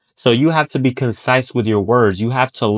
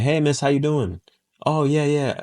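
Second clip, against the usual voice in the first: softer, gentle grandchild-like greeting; gentle with old lady; 3 semitones higher, 5 dB quieter than usual. Shown as a demonstration. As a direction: speak sweetly to a little old lady. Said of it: "bring the tone down".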